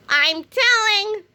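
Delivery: high, slow whiny tattle; high sing-song tattling kid. Acted. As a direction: drag it out like a tattletale. Kid voice in comic.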